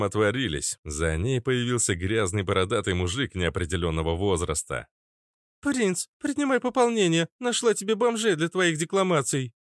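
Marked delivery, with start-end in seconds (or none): 5.6-9.5 put-on high-pitched sneering street girl; prickly redhead girl. (дерзкая девчонка, высоким голосом)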